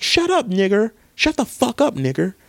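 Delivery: high, mocking hostile snap. Undercover [name] snaps at protester.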